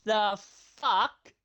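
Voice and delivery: high, loud the fuck; squeaky disbelief; shocked. Real, not acted. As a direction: yelp it in shock. Shocked reaction.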